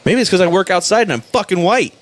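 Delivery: high-pitched, exasperated comeback; testy retort. Acted. Driver explaining his red face to cops, testy retort. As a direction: pitch it up, indignant at a dumb question. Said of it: "I get testy with cops".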